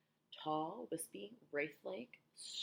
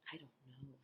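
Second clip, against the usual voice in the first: very soft, hushed answer; quiet murmured reply; softly; 2 semitones lower, 15 dB quieter, faster than usual. A narrator's reading. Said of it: "James answered softly".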